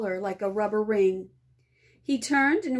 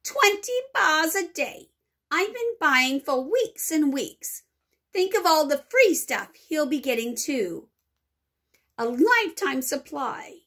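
angry; 5 semitones higher than usual. A narrator's reading. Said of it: "a large boy shouted angrily".

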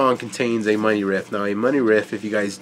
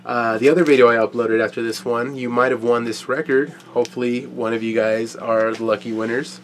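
usual speaking voice

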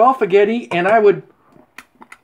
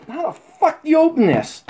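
usual speaking voice

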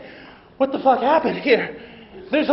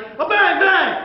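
mock gunshots; 10 semitones higher, 6 dB louder, much slower than usual. Showing fake gunshot.

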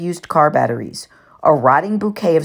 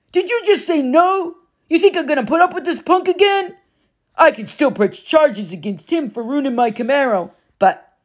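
higher, mocking bully stepfather; menacing Gabe; 11 semitones higher than usual. A narrator's reading. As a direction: sneer down at her for saying no. Gabe voiced in narration.

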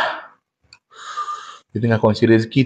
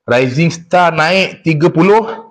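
slow announcing; 6 semitones higher, much slower than usual. Real, not acted. Announcing donation goal.

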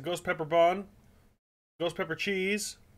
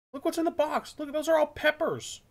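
high pained squeal; 12 semitones higher than usual. Real, not acted. Burning mouth from ghost peppers.

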